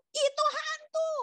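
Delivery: very high, drawn-out mock scream; high-pitched acted shriek of shock; startled cry. A narrator's reading. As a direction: cry out as if seeing a ghost. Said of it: "mereka terkejut dan berseru".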